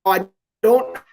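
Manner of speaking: choppy, robotic connection dropouts; robotic glitchy audio. Real, not acted. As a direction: voice breaking up like a bad connection. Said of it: "you turned into a robot there for a second".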